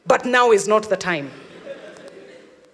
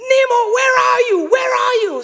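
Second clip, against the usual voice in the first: high, loud frantic scream; 9 dB louder, faster than usual. Retold or imitated. Cousin watching TV, screaming for him in panic. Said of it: "she's screaming".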